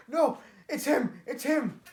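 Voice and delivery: high, loud panicked cry; terrified cry. Retold or imitated. Maul cries out in fear on seeing Palpatine, high and loud. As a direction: shriek it in terror. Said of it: "looks at him in fear".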